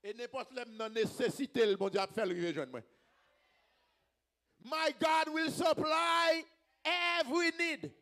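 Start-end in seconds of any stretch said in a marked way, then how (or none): 4.6-7.9 high, slow, stretched declaration; drawn-out preacher's call; ringing proclamation. Real, not acted. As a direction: hold out each word for the crowd. Proclaims the verse again.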